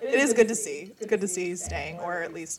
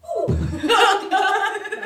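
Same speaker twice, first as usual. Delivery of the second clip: loud high laugh; 14 semitones higher, 8 dB louder than usual. Real, not acted. Laughing at cheer move.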